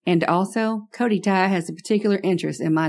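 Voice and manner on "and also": ordinary delivery, nothing marked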